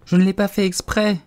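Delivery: high, loud pleading excuse; urgent, high-pitched apology; apologetic protest. A narrator's reading. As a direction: protest innocence as a distressed girl. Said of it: "dit la pauvre Alice pour s'excuser".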